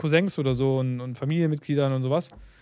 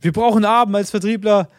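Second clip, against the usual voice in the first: höher und lauter, drängend; aufgeregt; 4 semitones higher, 4 dB louder than usual. Retold or imitated. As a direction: begeistert den Partner überzeugen wollen. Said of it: "aufgeregt war ich". (aufgeregt, höher und lauter am Telefon)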